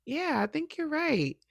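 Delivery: high, small retold concession; sheepish agreement. Client conceding to her therapist's reframe, high sheepish agreement.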